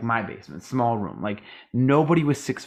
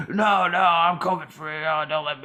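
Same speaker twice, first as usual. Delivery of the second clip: high whiny mock pleading; 6 semitones higher than usual. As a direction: whine like an entitled customer. Mocking pleading maskless people.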